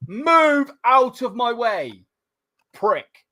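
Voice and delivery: high, slow, drawn-out retold order; exasperated retort. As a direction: drag it out, fed up with dawdlers. Retold anger at slow walkers.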